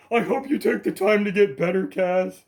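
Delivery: high, loud, saccharine mimicry of the commenter; mocking sweet voice. Mocking the commenter who copied his own words, sugary mimic. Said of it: "She was saying".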